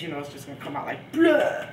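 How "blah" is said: alien burst. Pretend. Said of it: "like in Aliens".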